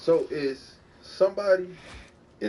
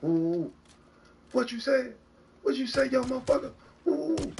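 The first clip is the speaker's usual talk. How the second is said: taunting trash talk; 9 semitones higher than usual. Retold or imitated. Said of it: "somebody get to talking at the mouth".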